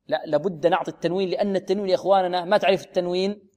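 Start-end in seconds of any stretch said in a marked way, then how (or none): none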